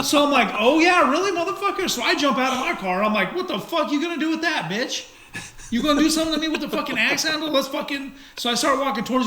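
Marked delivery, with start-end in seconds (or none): 0.6-1.9 high, mocking comeback with a laugh; retold challenge. Driver retelling his answer to the axe handle, mock-defiant. 3.4-8.1 higher-pitched, mock-aggressive challenge; retold taunt. Driver retelling his dare to the man with an axe handle, taunting.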